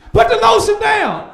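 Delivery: high, loud barked order; shouted command. Acted. Comedian yelling a slave-master order, loud shout.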